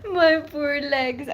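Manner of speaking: high, drawn-out playful whine; mock self-pity. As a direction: pretend to cry over your own misfortune. Mock self-pity after laughing story.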